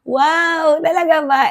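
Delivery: high, excited disbelief; retold happy shock; thrilled wow. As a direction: gush like you can't believe it. Reacting to surprise reward.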